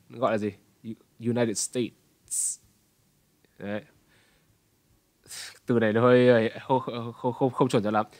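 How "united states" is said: slow "United States" with TS released on breath; breathed-out final TS. Shown as a demonstration. Teacher shows final T as breath, TS released. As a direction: stretch "States" into a hissed TS ending. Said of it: "thở ra ra".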